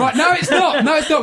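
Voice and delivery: mock-exasperated insistence. Real, not acted. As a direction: insist over the others, half laughing. Mock-exasperated overruling of co-host.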